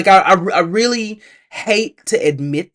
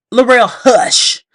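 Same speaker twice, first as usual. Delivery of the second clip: louder, slower hush; firm scolding; 7 semitones higher, 6 dB louder, slower than usual. Retold or imitated. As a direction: scold her more firmly.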